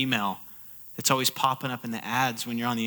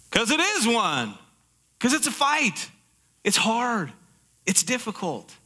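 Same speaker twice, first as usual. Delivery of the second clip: emphatic preaching; 7 semitones higher than usual. Acted. Preacher stressing the fight.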